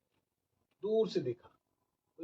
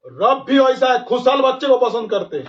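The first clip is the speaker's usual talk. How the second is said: higher, louder impassioned sermon; fiery preaching; 6 semitones higher, 7 dB louder than usual. Acted. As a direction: raise the voice and rouse the crowd. Preaching at full force.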